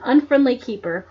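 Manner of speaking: ordinary delivery, nothing marked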